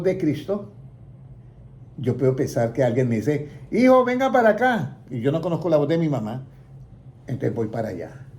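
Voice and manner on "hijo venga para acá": calling out. Retold or imitated. Parent calling the son to come over, calling out. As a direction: call across the yard to your son.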